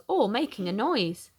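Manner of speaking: plain reading voice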